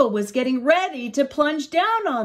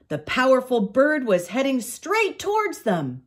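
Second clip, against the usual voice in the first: usual speaking voice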